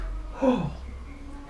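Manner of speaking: high, audible intake of breath; shocked gasp. Acted. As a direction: gasp in mock horror for listeners. Boy has been gobbled up.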